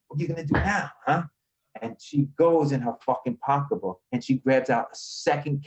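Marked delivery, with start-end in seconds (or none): 0.1-1.2 higher mock-taunting challenge; cocky taunt. Storyteller retells taunting the woman with the mace, cocky. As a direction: gloat at her, pitched up. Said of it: "and I'm like".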